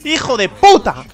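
angry yell. Real, not acted. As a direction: curse out loud at the game. Getting hit in game.

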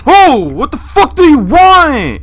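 high, loud, slow frightened yell; terrified drawn-out shout; scared yell. Real, not acted. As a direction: yell at the thing in the dark. Scared by game monster.

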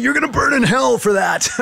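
imitating an irate coach yelling, high and loud; hammed-up high-pitched coach's tirade; furious shout. Coach to his center after a bad snap, shouting. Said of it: "and he shouts".

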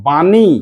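বাণী in two stretched syllables, long "aa". Shown as a demonstration. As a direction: draw out both vowels of বাণী. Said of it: "এর উচ্চারণ আ হয়".

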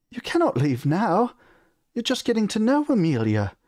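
high, anxious queen voice; fretting mother. A narrator's reading. Queen begging her son not to leave, fretting. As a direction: plead with a son about to go. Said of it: "his mother fretted".

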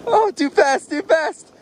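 rising alarm, too fast; startled cry of worry. Real, not acted. Kid going too fast downhill.

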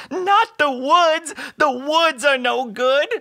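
high, loud, slow wail; hammed-up terror, each phrase stretched into a howl; panicked crying. A narrator's reading. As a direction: bawl it out in storybook dread. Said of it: "cried Todd".

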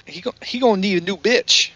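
high-pitched quoted catchphrase voice; Lance Henderson. Retold or imitated. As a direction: deliver it as a put-on character quote. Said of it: "in the words of our good friend".

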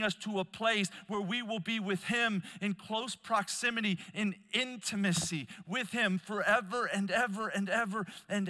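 high, loud sermon climax; impassioned preaching. Real, not acted. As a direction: drive the promise home with rising force.

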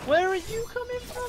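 high, loud startled shout; loud, high-pitched outcry; exasperated yell. Real, not acted. Gamer surprised by an attacker.

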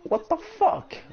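very high-pitched English with a French accent; poor English accent. Real, not acted. Streamer reacting to the game, poor English accent. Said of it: "Mon accent anglais est pas terrible".

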